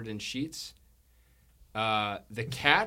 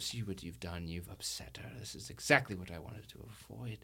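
hushed scolding; 4 semitones lower, 8 dB quieter than usual. Acted. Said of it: "Sylvia speaks up".

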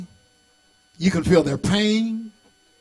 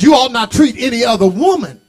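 usual speaking voice

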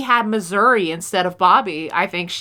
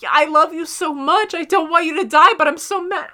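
higher-pitched, pleading mock outburst; exasperated affection; 6 semitones higher than usual. Voicing Bobby to reckless Dean, exasperated affection. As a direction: imitate loving someone while mad at them.

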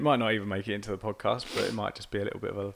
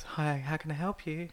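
quiet, higher polite phone greeting; telephone voice; 5 semitones higher, 7 dB quieter than usual. Shown as a demonstration. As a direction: answer like a receptionist on the phone. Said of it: "it's like having a telephone voice".

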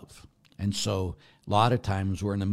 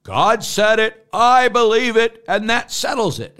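defiant declaration; 12 semitones higher, 10 dB louder than usual. Retold or imitated. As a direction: proclaim "God said it" like a cocky seminarian, stressing "said" and "I". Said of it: "I said that exact quote".

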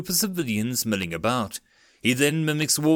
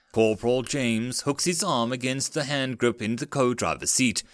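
usual speaking voice

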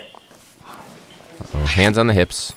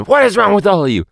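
mock-exasperated teacher, higher and louder; imitated teacher yell; 12 semitones higher, 6 dB louder than usual. Host mimics the teacher in the classroom clip, mock exasperated yell. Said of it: "This is a different teacher. He's going".

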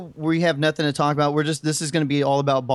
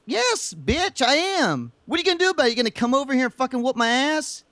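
taunting; 9 semitones higher than usual. Acted. Taunting cohost.

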